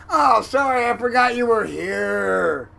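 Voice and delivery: shouted high and loud, slow at the end; loud offhand apology. Retold or imitated. The big crewmate apologizes loudly after the grab. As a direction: toss off a booming sorry.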